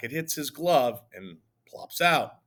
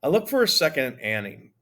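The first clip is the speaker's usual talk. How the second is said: ordinary delivery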